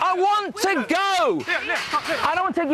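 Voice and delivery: drawn-out, high distressed plea; upset pleading. Real, not acted. Contestant begging to leave the show, slow and high-strung. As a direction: stretch each word out, close to breaking.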